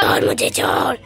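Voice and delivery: whispered rasping hiss; hissed witch voice. Acted. Witch hissing at the sleepwalking father, whispered threat. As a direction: hiss at the man.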